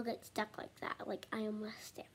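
unvoiced whisper. Real, not acted. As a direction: breathe it as an aside. Whispered aside.

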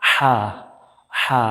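হা with a tight throat and a hoarse rush of breath; Arabic ha in হা, breathy and pressed; pressed throaty h. Shown as a demonstration. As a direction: squeeze the throat for হা. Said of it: "ফ্যাস ফ্যাস sound আসবে".

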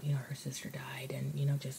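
hushed whisper to his mom. Retold or imitated. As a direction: whisper it so she can't hear. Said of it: "Simon was like".

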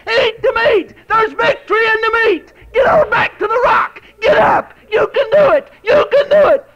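high, loud shouted rally cry; rousing shout. Acted. The eagle calling the weak eagles to get up, shouting. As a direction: rouse the fallen eagles to their feet.

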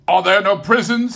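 high, loud bitter spirit; spirit mocking Scrooge. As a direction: throw his words back at him. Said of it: "turning on him ... his own words".

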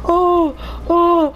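normal talking voice

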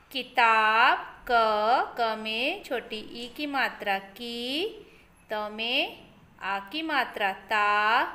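put-on slow syllable-by-syllable reading for kids; sounding out words. Shown as a demonstration. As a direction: spell out each syllable, then blend the word. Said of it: "लिखकर वाक्य को पूरा करना है".

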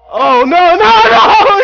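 high, loud panicked no; screaming no as death comes; panicked yell. Real, not acted. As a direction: yell it as you get shot. Getting killed in game.

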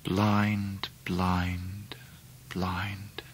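fading to whisper. Acted. Narrator echoing his aunt's words, fading to a whisper. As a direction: let the words die into a breath.

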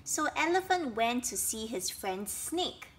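ordinary delivery

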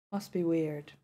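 usual speaking voice

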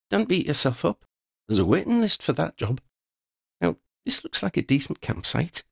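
a cheery friend cutting off self-blame; brisk Christy. A narrator's reading.

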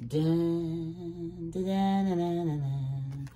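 mock dramatic suspense; suspense dun dun. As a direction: hum ominous suspense music. Suspense music imitation.

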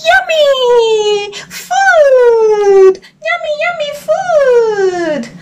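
very high, delighted frog squeal; baby frog thrilled to reach the food; excited high frog. Acted.

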